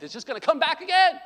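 high, loud preaching burst; emphatic warning. Real, not acted. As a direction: raise the voice to drive it home. Preacher drives home his warning.

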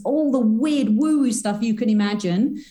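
ordinary talk

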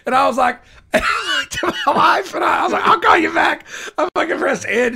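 high, loud burst of storytelling; high, loud excited telling of the speakerphone moment; excited retelling. Real, not acted. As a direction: squeal it out laughing at the memory. Panicked retelling of the hang-up.

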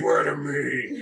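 slow, drawn-out mock Batman; echoing a superhero threat, stretched out. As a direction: drag out the Dark Knight demand. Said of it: "trying to do a Batman voice".

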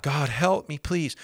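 high, pleading whine; begging prayer. Retold or imitated. A believer begging God to change him, high pleading whine. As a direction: plead with God like a helpless beggar.

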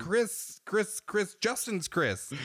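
flustered explaining. Retold or imitated. Husband frantically explains to his wife who texted, flustered. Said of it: "And I was like".

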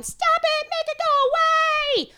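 put-on high, whiny little boy yelling; child shrieking. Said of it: "slapping him in the face going".

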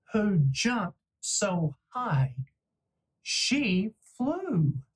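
gentle bedtime storytelling voice; lively storybook narration for a child; reading to children. A narrator's reading. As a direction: read it like a picture book to your kid. Dad reads picture book aloud.

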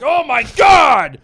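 high, drawn-out shout of panic; mock horrified scream. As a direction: cry out like an overwhelmed news viewer. Acting out a terrified news viewer.

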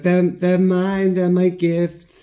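high, slow, entranced voice; high drawn-out possessive croon; possessive witch. Retold or imitated. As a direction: croon over your gifts like a madwoman. Nancy gloating over dead sharks.

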